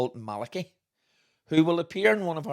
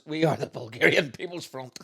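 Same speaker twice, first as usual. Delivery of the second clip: mock Bulgarian; 3 semitones higher than usual. Fake rebel declaration.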